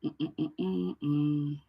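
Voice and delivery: soft little hums on one note; idle humming. Real, not acted. Host waiting for viewers to join, softly humming. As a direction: hum quietly to pass the time.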